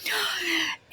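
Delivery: sharp surprised intake of breath; quick storyteller gasp. Acted. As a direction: gasp as if noticing something.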